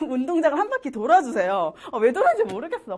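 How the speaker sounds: ordinary delivery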